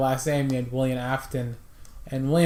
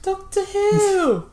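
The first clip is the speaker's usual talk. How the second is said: much higher, drawn-out "Doctor Who"; excited; 14 semitones higher, slower than usual. Acted. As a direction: sing out the title like a fan.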